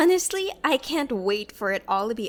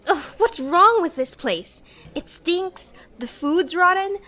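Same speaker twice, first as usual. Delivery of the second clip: disgusted; 5 semitones higher, 7 dB louder than usual. Acted. Disgusted by moldy food.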